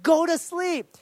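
re-enacted angry yell, high and loud; retold shout. Dad to baby who won't stop crying, retold shout. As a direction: yell it like a dad at his wits' end. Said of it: "I was shouting at him".

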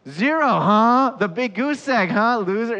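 loud, high, drawn-out jeer; mocking taunt. Jesus imagined jeering Peter's empty catch, mocking taunt. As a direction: rub it in with hammed-up scorn. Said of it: "and Jesus doesn't go".

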